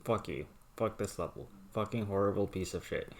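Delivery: ordinary talk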